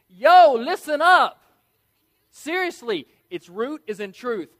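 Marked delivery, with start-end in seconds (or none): 0.1-1.4 loud, high, slow street-slang call; streetwise yo. Pretend. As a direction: belt it like a buddy grabbing attention. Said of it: "Basically, it means".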